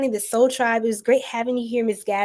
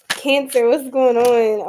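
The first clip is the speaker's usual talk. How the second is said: ordinary talk, nothing marked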